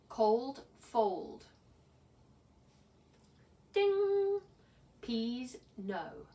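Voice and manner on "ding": high loud sung "ding" bell. Shown as a demonstration. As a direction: ring out like a bell. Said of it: "ding if it rhymes".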